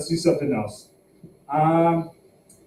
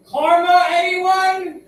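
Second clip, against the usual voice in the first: much higher, louder, baiting the crowd; high hopeful punchline; 5 dB louder, much slower than usual. Real, not acted. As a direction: pitch it way up and fish for a laugh.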